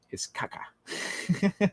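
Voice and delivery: whispered punchline. Acted. Streamer answering chat about the Russian accent, whispered. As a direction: whisper it, slow and secretive.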